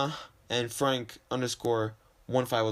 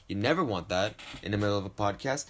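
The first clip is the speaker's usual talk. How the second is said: ordinary talk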